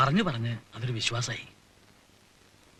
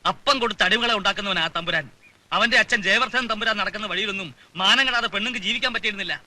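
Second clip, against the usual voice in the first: heated, raised voice, higher and louder; indignant outburst in a family quarrel; angry denunciation; 5 semitones higher, 5 dB louder than usual. Acted. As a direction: denounce the man with rising indignation. Heated family quarrel, denouncing a landlord.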